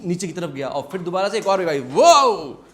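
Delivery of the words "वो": loud "whoa", swooping up and down; loud wave whoosh. Shown as a demonstration. Teacher showing the next wave arriving, loud swooping whoosh. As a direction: imitate another surge of air hitting.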